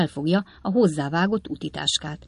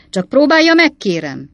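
ringing, much higher, louder challenge; 8 semitones higher, 10 dB louder than usual. A narrator's reading. Slark daring Pott, in a loud ringing voice. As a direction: bellow the dare. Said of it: "ismételte Slark harsány hangon".

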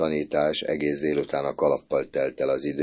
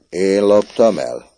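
slow, louder outraged question; indignant cry; 4 semitones higher, 8 dB louder, slower than usual. A narrator's reading. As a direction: cry out beside himself. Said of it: "kiáltott Böszörményi, magából kikelve".

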